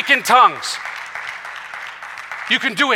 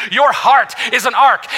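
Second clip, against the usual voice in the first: ordinary talk, nothing marked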